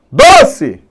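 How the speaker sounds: loud call leaning on DO; shouted at the gate. Shown as a demonstration. Teacher demonstrating the stressed syllable, shouting at the gate. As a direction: call "doce" out like someone's name. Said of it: "O famoso grita no portão".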